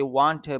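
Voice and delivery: "want to" with the t pronounced; full-form want to. Shown as a demonstration. Teacher quoting what natives avoid, full "want to". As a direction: say "want to" as two words. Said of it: "You will not see any native".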